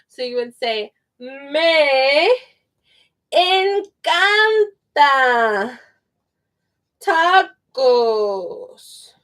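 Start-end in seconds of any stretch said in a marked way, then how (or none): 1.2-9.1 very slow, word by word; slow teaching model. Shown as a demonstration. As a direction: stretch each word out for learners.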